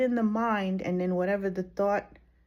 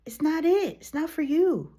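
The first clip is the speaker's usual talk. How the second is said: louder, higher, insistent; emphatic; 8 semitones higher, 5 dB louder than usual. Real, not acted. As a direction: drive the point home. Firm advice, punched lines.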